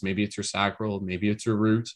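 ordinary talk, nothing marked

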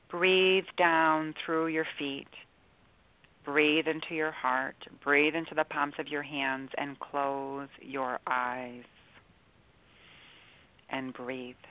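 slow, soft meditation voice; guided meditation. Acted. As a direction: ease them into calm, softly.

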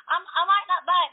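high, loud eager promise; excited insist. Retold or imitated. To the car owner, high loud eager pitch. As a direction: talk him into it.

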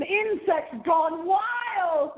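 high, drawn-out mock shout; hammed-up announcer. Host teasing weird monster films, hammed-up excited cry. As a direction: stretch it out high like a monster-movie tease.

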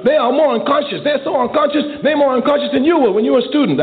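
high-pitched mock old man, insistent; imitating elderly man. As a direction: squeak out the old man's complaint. Voicing the elderly man's complaint.

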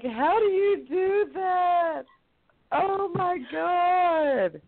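very high, slow, astonished squeal; amazed girl. Retold or imitated. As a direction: gasp it out in awe.

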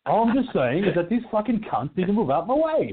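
hammed-up British rant; mock British accent. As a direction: imitate drunk Brit rant. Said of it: "He's gone full Brit on me".

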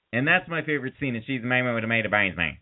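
ordinary talk

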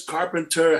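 normal talking voice

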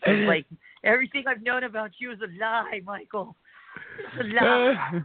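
mock dramatic betrayal. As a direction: play it like a soap opera betrayal. Mock betrayal over [name].

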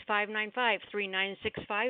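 usual speaking voice

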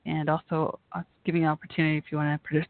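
ordinary delivery

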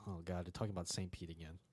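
low, quiet, weary groan of a complaint; mock a fed-up listener under your breath. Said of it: "you're just like".

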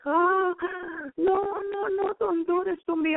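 high, crying plea of a cornered demon; terrified pleading demon. Retold or imitated. Demon begging the preacher not to hurt him, high crying plea. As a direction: whimper it out, begging for mercy. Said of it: "he was scared to death".

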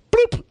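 very high vocal "bloop"; mimicked electronic blip, high; mocked scanner beep. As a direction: squeak out a fake scanner blip. Imitates his own scanner beep.

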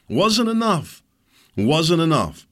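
high, loud, bitter repeat; loud indignant snap; angry outburst. Real, not acted. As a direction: hammer it home in anger. Furious at neighbour costing him money.

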